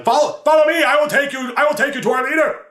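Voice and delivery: shrill-free high frantic guide, shouting; insane Darrow. Retold or imitated. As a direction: beckon like an overeager crazed guide. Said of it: "one of the Darrow is leading".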